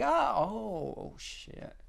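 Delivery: imitated dismay, fading to a breath; mock shock. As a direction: act out their dismayed surprise. Imitating people's shocked reaction.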